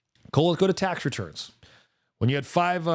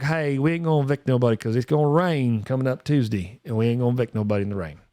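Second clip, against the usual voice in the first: put-on country accent; 2 semitones lower than usual. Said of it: "so I can do this accent".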